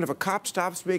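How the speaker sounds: ordinary delivery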